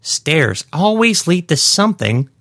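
put-on high, slow haughty old woman; a put-out old lady threatening; indignant old lady. Shelley's voice.

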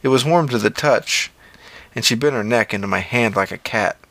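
plain reading voice

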